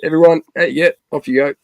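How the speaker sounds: calling out. Retold or imitated. Friend clearing onlookers away from a sick mate, calling out. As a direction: imitate shooing a crowd off.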